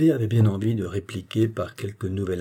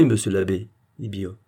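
ordinary delivery, nothing marked

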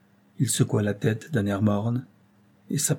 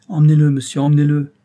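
put-on loud, high, anguished cry of an old man; a heartbroken grandfather shouting; old man's cry; 5 semitones higher, 11 dB louder, faster than usual. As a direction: cry out to make them take the boy away. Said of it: "cria monsieur Maldon".

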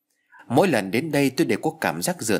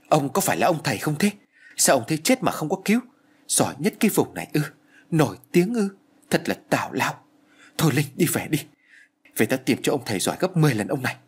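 losing her temper; 4 semitones higher than usual. A narrator's reading. Angry friend scolds the shaman who refused to help, indignant. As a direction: scold the shaman, building to a sharp peak. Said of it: "Trang dường như mất bình tĩnh nói".

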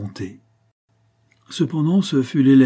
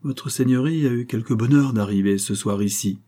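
usual speaking voice

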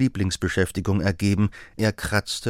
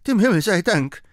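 high, loud cry of relief; relieved exclamation; 11 semitones higher, 6 dB louder than usual. Acted. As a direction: burst out with thanks, much higher and louder. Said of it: "rief die alte Frau". (erleichterter Ausruf, viel höher und lauter)

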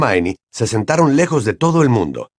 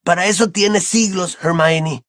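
put-on raised-pitch boy's retort; snapped retort; 7 semitones higher than usual. A narrator's reading. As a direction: toss it back as a quick objection. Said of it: "espetó Ron". (réplica espetada, en tono más agudo)

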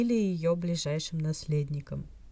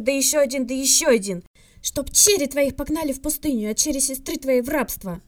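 loud, high ritual cursing; furious curse; 8 semitones higher, 8 dB louder than usual. A narrator's reading. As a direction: hurl the ancient curses like a spell. Jim hurls Vulcan curses. (Джим яростно выкрикивает древние вулканские проклятия)

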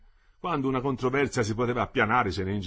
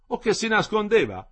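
higher, louder mocking exclamation; exclaimed; 9 semitones higher, 7 dB louder than usual. A narrator's reading. The old foreman exclaims at the engineer's arrival. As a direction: call out the jibe at the boss. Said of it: "esclamò il decano".

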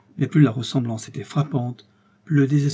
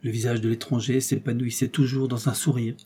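usual speaking voice

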